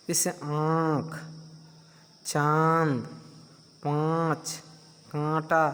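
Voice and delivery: आँख, चांद, पाँच said slowly with nasal vowels; nasal examples. Shown as a demonstration. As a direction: push the vowels through the nose. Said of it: "नाक पर जोर पड़ रहा है ना".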